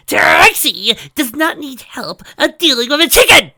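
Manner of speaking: loud, high, slow insistence; stubborn. A narrator's reading. Trixie refusing Spike's suggestion, loud and stubborn. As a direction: dig your heels in. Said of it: "in a stubborn tone".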